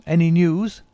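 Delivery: excited cry with a rising close. Acted. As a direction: beg for news a second time. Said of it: "he cried".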